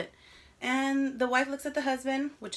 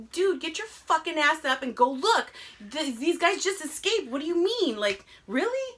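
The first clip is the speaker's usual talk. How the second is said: higher and louder, exasperated; mock angry rant; 5 semitones higher, 3 dB louder than usual. Venting at the husband in the movie, exasperated and loud. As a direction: yell at the dumb husband to go look.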